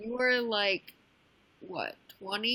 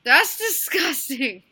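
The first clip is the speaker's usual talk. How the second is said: disgusted; 3 semitones higher, 8 dB louder, slower than usual. Real, not acted. Friend's joke about age.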